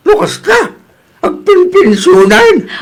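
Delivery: high, startled disbelief; surprised. Acted. Husband surprised his wife got pension money, high startled question. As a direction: blurt it out in shock.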